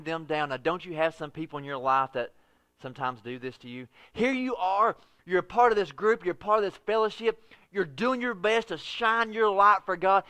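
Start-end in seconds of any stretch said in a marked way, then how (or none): none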